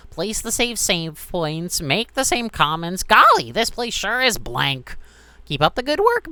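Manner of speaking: loud, high mocking flower; Flowey voice. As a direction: taunt the player, high and loud. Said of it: "and he'll go".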